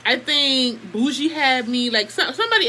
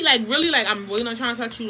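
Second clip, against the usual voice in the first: normal talking voice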